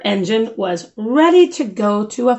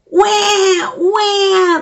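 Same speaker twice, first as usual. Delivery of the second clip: put-on high, loud baby wails; mock baby crying; crying babies; 10 semitones higher, 4 dB louder than usual. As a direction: wail like a baby. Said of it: "The babies woke up crying".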